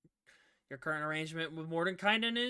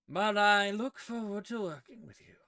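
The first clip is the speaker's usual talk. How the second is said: higher, louder put-on character voice; patron figure, cordial; 5 semitones higher, 8 dB louder than usual. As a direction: project a cordial sign-off in the patron's voice. Game master resumes patron's voice.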